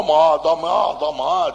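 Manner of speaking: loud, heavy Tuscan demand; thick Tuscan, rising insistently. Retold or imitated. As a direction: demand it in broad Tuscan, swallowing the consonants. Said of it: "parla il toscano un pochino, eh, carcato".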